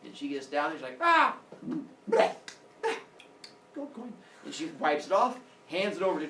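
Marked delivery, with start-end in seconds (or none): 1.0-4.1 high loud yelp and spluttering blah; spitting out a coin. Acted. Girl in the underworld spitting out her coin, loud high yelps. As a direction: spit the coin out with a squeal, then brighten.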